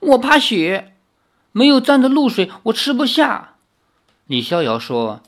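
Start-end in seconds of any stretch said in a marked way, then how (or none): 0.1-3.5 timid little demon. Acted. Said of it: "另一只妖说".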